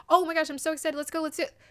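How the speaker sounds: a parody of a giddy contestant, hammed-up thrill; mock hyped excitement. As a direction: ham up the gushing excitement. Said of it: "It's too hyped for me".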